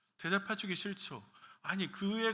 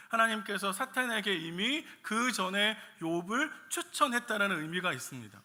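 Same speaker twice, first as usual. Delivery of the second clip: ordinary talk, nothing marked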